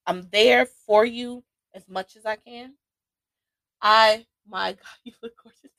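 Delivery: ordinary talk, nothing marked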